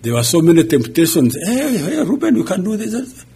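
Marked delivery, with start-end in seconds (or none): 1.4-3.2 high, coaxing tempter voice urging Reuben on; tempting voice. Acted. Pastor voicing the temptation to stay in America, high coaxing tempter. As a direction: play the sly inner voice of temptation, pitched way up.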